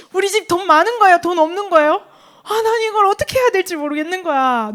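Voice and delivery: loud, high, bewildered mock plea; confused child. Preacher as her younger self asking about family money, loudly confused. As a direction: act out a kid at a total loss. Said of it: "너무 헷갈리는 거지".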